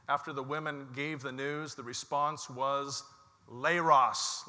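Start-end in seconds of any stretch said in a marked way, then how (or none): 3.5-4.4 demo word. Shown as a demonstration. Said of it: "you have a part to play".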